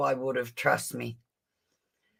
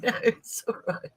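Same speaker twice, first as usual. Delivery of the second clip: tearful reassurance; 7 semitones higher than usual. Real, not acted. I started crying.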